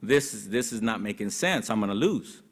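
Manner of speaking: a beaten candidate doubting himself under his breath; depressed inner voice. Retold or imitated. Candidate alone on election night, quietly despairing. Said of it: "and I'm thinking".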